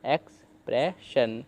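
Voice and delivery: एक्सप्रेशन drawn out slowly, ending on a clear shun; slow pronunciation demo. Shown as a demonstration. Teacher showing students the word, slow and careful. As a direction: say एक्सप्रेशन slowly so learners hear each sound. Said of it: "जैसे आपने देखा ही है".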